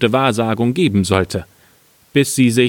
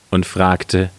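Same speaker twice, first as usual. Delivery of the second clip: plain reading voice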